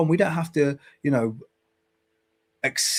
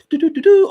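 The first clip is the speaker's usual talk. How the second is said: high, loud mock carefree ditty; loud sing-song "do do do"; carefree doo-doo humming; 10 dB louder, faster than usual. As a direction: imitate someone trotting off merrily. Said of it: "He'll be like".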